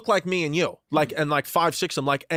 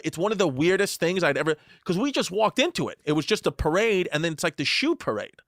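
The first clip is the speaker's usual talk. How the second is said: usual speaking voice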